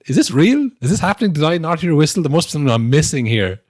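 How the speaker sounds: can this really be happening; mock stunned striker; stunned disbelief. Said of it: "he's thinking".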